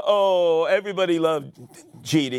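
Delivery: ordinary talk, nothing marked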